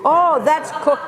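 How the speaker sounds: hammed-up "aha" moment; mock realisation. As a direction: play at suddenly working it out. Acts out recognising the object.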